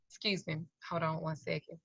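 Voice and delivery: very soft, low excuse me; quiet, polite interruption; hushed aside. Retold or imitated. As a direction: say it small and controlled, under the voice.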